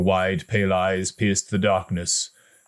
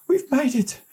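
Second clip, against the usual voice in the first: tearful relief. A narrator's reading. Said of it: "with tears in his eyes".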